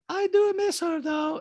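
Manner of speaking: put-on very high-pitched accented caricature; squeaky falsetto mimicry of a reality star; Diana impression. Host mimics reality star in recap, high-pitched caricature. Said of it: "And Diana goes".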